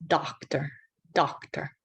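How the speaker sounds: whispered model word; whispered demo word. Shown as a demonstration.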